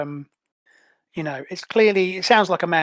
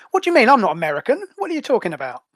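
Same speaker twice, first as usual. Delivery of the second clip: indignant surprise; 5 semitones higher, 5 dB louder, much faster than usual. Acted. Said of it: "Technon says".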